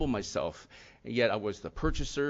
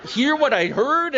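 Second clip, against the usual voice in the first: much higher, louder, shocked exclamation; stunned disbelief; 7 dB louder, much slower than usual. Retold or imitated. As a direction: replay the moment his daughter silenced him, astonished. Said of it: "It's like".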